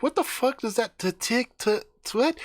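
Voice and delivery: high, tripping over the word; high puzzled stammer; stammering confusion. Retold or imitated. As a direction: stumble trying to read the sign. Guys puzzled by sign.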